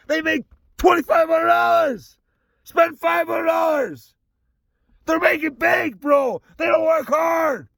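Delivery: exasperated, yelling tirade; angry rant. Real, not acted. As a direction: keep railing at full pitch, stretching the line ends. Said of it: "Sorry I went off there".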